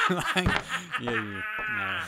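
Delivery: high, through laughter; words squeezed out between laughs; laughing. Real, not acted. [name] still laughing after his own joke.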